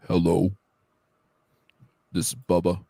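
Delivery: Bubba voice. Pretend. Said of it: "it's like".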